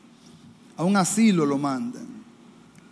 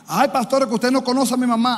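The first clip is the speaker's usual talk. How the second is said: higher, louder, lightly whining protest; whiny congregant; 6 semitones higher, 4 dB louder than usual. Retold or imitated. A church member protesting to the pastor, lightly whining. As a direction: play the excuse-maker lightly.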